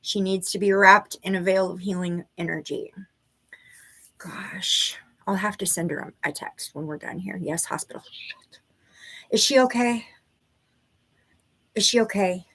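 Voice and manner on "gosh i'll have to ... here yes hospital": whispered worried aside; half-voiced, under her breath. Real, not acted. Aside while worried about friend.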